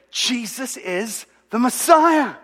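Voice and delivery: slow, high, theatrical proclamation; dramatic announcement. Retold or imitated. Husband reveals big news to his wife, over-dramatic. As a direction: draw it out like a hammy stage reveal. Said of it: "I'm just being dramatic here".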